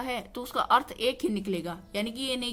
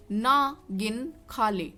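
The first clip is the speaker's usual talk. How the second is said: slow "ना", pause, "गिन", pause, "खा ले"; separated words; much slower than usual. Shown as a demonstration. Teacher introducing an example, "ना" and "गिन" slowly separated. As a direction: keep "ना" and "गिन" apart as two words. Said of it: "यानी कि गिन मत, चुपचाप खा ले".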